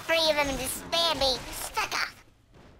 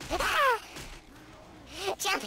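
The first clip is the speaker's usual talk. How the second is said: usual speaking voice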